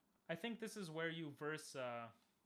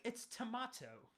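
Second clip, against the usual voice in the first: drawn-out, higher-pitched Australian protest; mock Australian accent; 9 semitones higher, slower than usual. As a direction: indignantly correct it in an Australian accent. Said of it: "they would be like".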